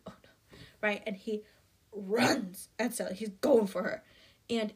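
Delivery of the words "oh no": barely voiced, tiny aside; small, sinking murmur; hushed mock dread. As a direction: let it out almost in a whisper. Voices the audience's dread in retelling.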